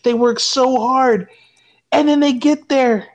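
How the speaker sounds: long-suffering fan venting; exasperated rant. Real, not acted. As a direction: pour out years of disappointment. Fan unleashing built-up rage.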